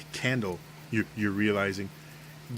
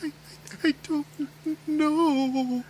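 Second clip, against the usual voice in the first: put-on much higher, sobbing little girl; crying child; slower than usual. Lost girl bawling as she is asked about parents, sobbing. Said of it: "And she starts bawling again".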